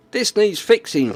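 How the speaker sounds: high quoted voice; quoted client voice. Retold or imitated. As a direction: raise the pitch for the quote. Quoting the phase name again.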